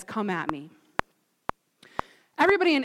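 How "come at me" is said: low, soft "come at me"; quietly thrown-down dare; understated challenge. Retold or imitated. Goliath's challenge retold, understated.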